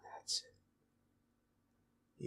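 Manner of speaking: barely voiced encouraging whisper. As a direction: murmur it softly to calm them. Soothing partner in an intimate moment.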